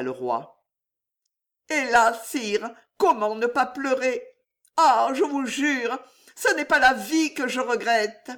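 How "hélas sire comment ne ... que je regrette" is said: a touch of tears, higher pleading; understated weeping young woman; mild tearful plea. Acted. Narrator voices Juliette.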